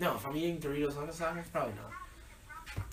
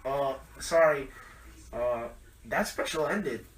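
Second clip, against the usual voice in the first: loud, high drive-thru speaker voice; drive-thru worker voice; 3 semitones higher, 8 dB louder than usual. Retold or imitated. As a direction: do the bored drive-thru employee over the speaker. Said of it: "Are you doing a drive-thru voice?".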